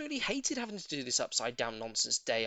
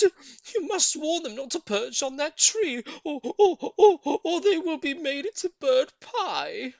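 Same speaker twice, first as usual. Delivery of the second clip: excited monkey; 11 semitones higher, 10 dB louder than usual. A narrator's reading. Mugglewump urges bird.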